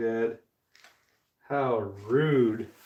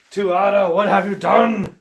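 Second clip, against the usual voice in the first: higher, louder exasperated call; mock scolding; 8 semitones higher, 8 dB louder than usual. Real, not acted. Exasperated streamer blames his furnace, mock scolding. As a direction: scold the furnace like a naughty pet.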